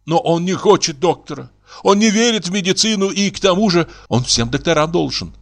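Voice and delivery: lightly voiced worried woman, high-pitched; mildly theatrical feminine fretting; anxious neighbour woman.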